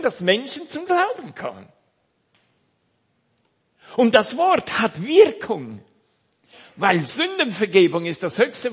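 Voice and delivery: loud, high, fervent sermon voice; preaching at full force. Acted. Preacher urging the congregation to hear the Word, full-force preaching. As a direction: preach it out to the whole hall.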